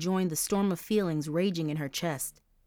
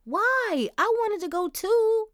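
much higher, whiny plea; whining kid; 4 dB louder, slower than usual.

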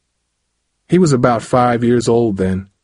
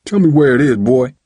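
high, louder angry order; demanding; 4 semitones higher, 5 dB louder than usual. A narrator's reading. Father presses son for the address, demanding. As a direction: press him hard for an answer. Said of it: "Dad demanded".

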